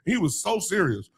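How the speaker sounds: ordinary delivery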